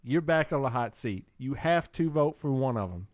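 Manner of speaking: ordinary talk, nothing marked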